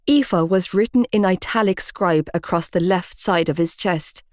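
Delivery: ordinary delivery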